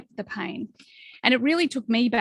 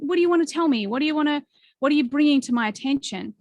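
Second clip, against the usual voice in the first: higher, inviting questions; friendly questioning; 5 semitones higher, 4 dB louder, faster than usual. Acted. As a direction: ask it like a welcome old friend. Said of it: "just be like".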